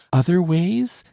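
high, loud surprised outburst; startled exclamation. A narrator's reading. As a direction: cry it out in sudden alarm. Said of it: "exclaimed Solange".

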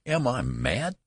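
high-pitched, contained outrage; thundering. A narrator's reading. Father's terror turning to rage, thundering mildly. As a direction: demand it with mild thunder, pitch swooping up. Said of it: "thundered Mister Button".

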